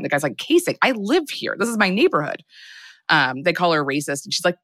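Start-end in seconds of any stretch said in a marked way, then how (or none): 0.4-2.4 high incredulous retort; retold indignant protest; indignant disbelief. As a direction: voice an accused teen protesting, pitched up. Teen indignant at accusation.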